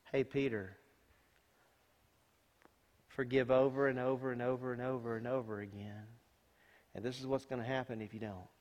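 soft, calm counsel; a lightly voiced Lord counseling Peter; gentle retelling. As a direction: tell Peter kindly.

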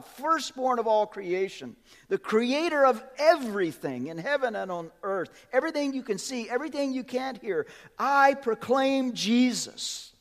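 rising pulpit proclamation, slowing on the last line; fervent preacher reading scripture; preaching proclamation. Acted. Proclaims Paul's words from the pulpit.

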